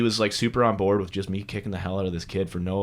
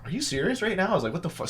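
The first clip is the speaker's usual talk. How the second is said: incredulous; 9 semitones higher, faster than usual. Retold or imitated. Driver reacting privately to the cop, incredulous. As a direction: react in hushed mock disbelief. Retold disbelief at the cop's words.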